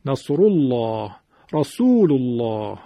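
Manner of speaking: نصر الله، رسول الله slowly, with a thick L and dark long 'aa'; heavy lam. Shown as a demonstration. Teacher showing students how big it sounds, heavy lam. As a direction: swell the L of الله in both phrases.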